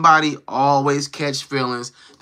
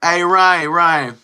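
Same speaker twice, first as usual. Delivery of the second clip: calling out; 5 dB louder, much slower than usual. Real, not acted. Calling out to a chatter.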